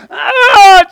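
loud, high imitated wail; crying-out wail. Preacher imitating Hannah crying out before God, loud wail. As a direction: let out an acted cry like dry heaves. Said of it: "She's just really crying out".

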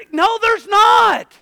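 high, drawn-out preacher's rebuttal; impassioned sermon denial; forceful preaching. Real, not acted. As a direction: cut down the doubt emphatically.

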